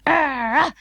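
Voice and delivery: mock dog barking frantically; frantic dog bark. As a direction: bark like a panicked little dog. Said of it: "he barked frantically".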